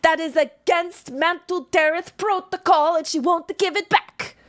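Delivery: high, loud furious complaint; angry accusation. Acted. Dwagar ranting about theft.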